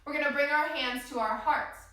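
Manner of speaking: ordinary talk, nothing marked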